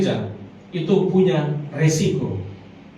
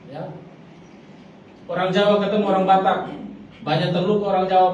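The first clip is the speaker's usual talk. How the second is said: normal talking voice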